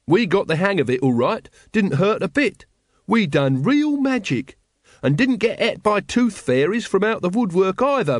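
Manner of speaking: high-pitched, boastful character voice; Watchtower. A narrator's reading. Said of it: "said Brother Watchtower".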